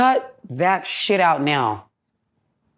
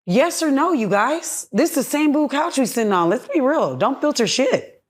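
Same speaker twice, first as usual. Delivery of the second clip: heated confrontation, higher; 8 semitones higher than usual. Retold or imitated. Retold argument, confronting him on couch.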